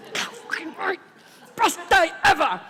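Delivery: high, squealed anticipation; giddy excitement. Acted. Addict alone before the party for one, giddy. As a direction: burst with mock glee.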